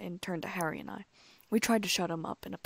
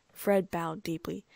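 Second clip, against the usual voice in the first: ordinary delivery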